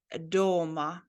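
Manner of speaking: "doma" stressed on the O; long stressed O in "doma", short final a; stress on first syllable. Shown as a demonstration. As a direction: lean on the first vowel of "doma". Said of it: "it has the emphasis on letter O".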